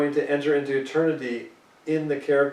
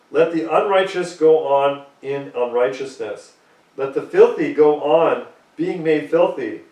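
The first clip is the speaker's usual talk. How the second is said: loud, measured reading of the verse; solemn scripture reading; 5 dB louder than usual. Acted. Preacher reads Revelation to his congregation, loud and solemn. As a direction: proclaim each line like a decree.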